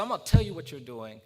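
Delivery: loud, forceful declaration; imitated old man's emphatic rebuke; stern old man. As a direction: come back hard like a stern elder. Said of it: "with this very stern look on his face".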